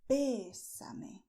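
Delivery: "bésame" whispered, BÉ stressed. Shown as a demonstration. Teacher to learners, whispering "bésame" with stress on the E. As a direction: breathe "bésame" leaning on the E. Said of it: "stressing the E".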